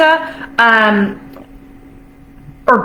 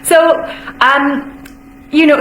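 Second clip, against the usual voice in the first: ordinary delivery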